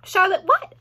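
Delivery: high, loud, eager question. A narrator's reading. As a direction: ask it keenly. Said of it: "asked Wilbur eagerly".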